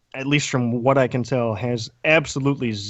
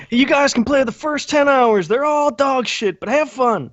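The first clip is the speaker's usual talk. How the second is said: mock developer pitch; 11 semitones higher than usual.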